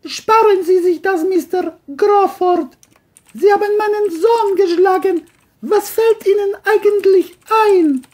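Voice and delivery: high, loud furious mother; angry. Acted. The mother confronts John about hitting her son, high and angry. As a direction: tell him off hard. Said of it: "sagte wütend".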